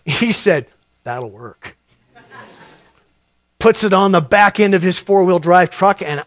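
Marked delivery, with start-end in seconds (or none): 1.0-1.8 low, soft deadpan answer; laconic reply. Retold or imitated. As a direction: answer low and sure, as the local. Local's laconic answer to naive question.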